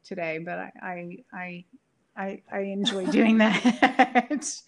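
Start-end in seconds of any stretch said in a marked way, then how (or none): 2.2-4.6 slow words broken by a laugh. Real, not acted. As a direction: laugh through the line. Laughing about her accents.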